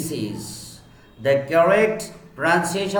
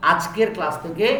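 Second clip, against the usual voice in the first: usual speaking voice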